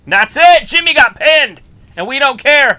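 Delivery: very high, loud cheer; excited shouting. Real, not acted. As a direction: shout it out like a triumphant fan. Cheering a pin on live broadcast.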